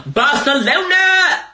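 high, slow, loud call of "Barcelona"; excited call. Real, not acted. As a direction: shout the city's name to the sky. Calling out to city.

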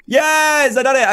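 high, excited cheer; elated gamer's yes; triumphant. Real, not acted. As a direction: celebrate the landing, pitch shooting up. Celebrates a successful jump.

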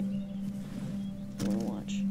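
hushed documentary whisper; whispered nature documentary. Pretend. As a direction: whisper like a wildlife narrator. Whispered wildlife narration.

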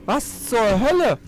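high, slow shocked exclaim; stunned and shaken, drawn out; scared horror game streamer. Real, not acted. Shocked after monster attack.

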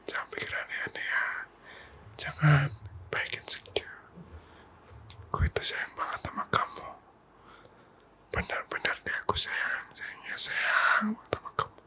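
hushed, close whisper of reassurance; intimate whisper. Acted. Girlfriend reassuring her partner not to feel insecure, whispered. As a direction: murmur sweet nothings right into his ear.

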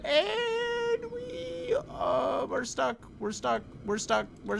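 very high drawn-out squeal easing into repeats; high-pitched strain. Real, not acted. Player stuck in the game, high-pitched repeated complaint. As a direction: squeak it out, stepping down gradually.

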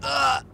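short, loud high "Ah!"; sudden startled cry; startled yelp. Real, not acted. Startled outburst in game.

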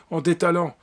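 usual speaking voice

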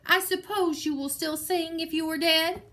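lightly hammed-up threat; half-hearted booming threat; thundered. A narrator's reading.

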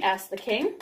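plain reading voice

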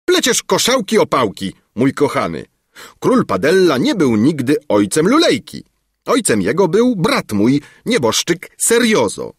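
high, emphatic royal contradiction; scoffing correction. A narrator's reading. King setting the minister straight on parentage, scoffing. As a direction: dismiss the nonsense and correct him.

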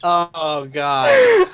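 loud high gasp through laughter; helpless laughing. Real, not acted. Friend cracking up at the impression, helpless laughing. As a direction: squeal it out mid laughing fit.

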